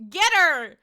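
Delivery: loud playful call; playful mock callout; mock shout. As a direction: shout it in jest. Mock calling out.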